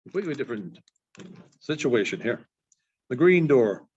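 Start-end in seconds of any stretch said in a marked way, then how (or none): none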